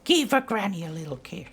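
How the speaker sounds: put-on high, quick creepy old-person voice; creepy granny. Streamer imitates a cartoon creep asking for a kiss, creepy. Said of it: "That actually sounded like that freaking".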